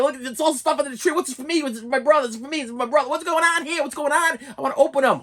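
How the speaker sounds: put-on child finding presents under the tree, high and eager; excited kid. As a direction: bounce with eagerness.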